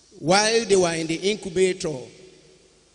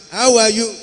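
How are you asked loudly, HOW stressed and high; prompting question; 5 semitones higher, 9 dB louder than usual. Shown as a demonstration. Pastor cueing the boy to answer, loud clear prompt. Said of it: "When I say, "How are you?".

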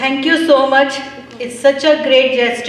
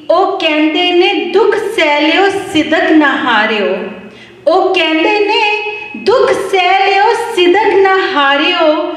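half-sung verse, each line repeated higher; devotional poem recitation; 4 semitones higher than usual. Acted. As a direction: chant it like a gurdwara poem, lifting the repeats.

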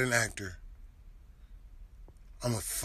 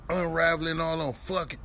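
ordinary talk, nothing marked

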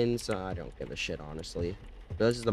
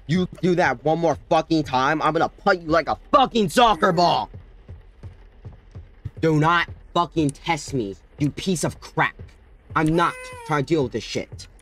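angry threat; 7 semitones higher, 7 dB louder than usual. Real, not acted. Threatening the baby.

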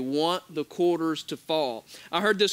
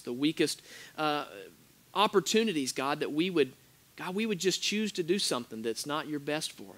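soft, low, hushed praying; earnest prayer; 4 semitones lower, 3 dB quieter than usual. Acted. Pastor praying for the congregation, soft and earnest. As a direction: pray quietly and sincerely.

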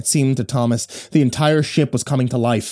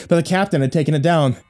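ordinary delivery, nothing marked

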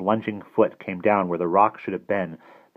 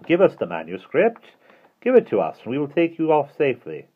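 higher, louder coaxing demand; light sneering voice; 8 semitones higher, 5 dB louder than usual. A narrator's reading. Withers demands the manuscript from the children, sneering. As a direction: call it across, light and sneering. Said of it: "Withers' light, sneering voice".

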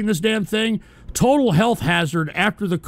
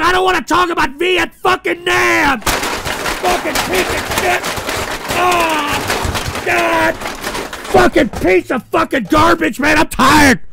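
high, loud exasperated shouting; hammed-up meltdown; 9 semitones higher, 4 dB louder than usual. Host melts down at chat's Vietnam jokes, shouting. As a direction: scream it like a man at the end of his rope. Breakdown rant at the chat.

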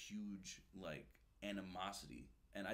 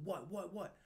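impatient snap; 10 semitones higher, slower than usual. Retold or imitated. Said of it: "He's like".

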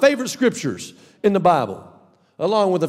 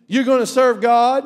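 usual speaking voice

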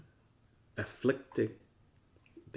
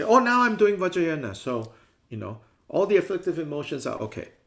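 higher, louder, breezy mock voice; mock casual excuse; 7 semitones higher, 9 dB louder than usual. Teacher mocking lax practitioners, in a higher, louder breezy voice. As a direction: parody someone excusing everything as Vajrayana. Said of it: "the view of the other people, which says".

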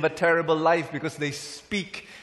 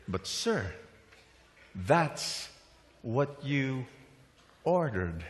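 quiet waiter reply; 2 semitones lower, 5 dB quieter, much slower than usual. Acted. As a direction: let each piece land quietly, haltingly. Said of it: "And the waiter said".

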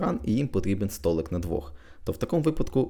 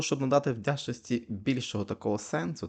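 ordinary talk

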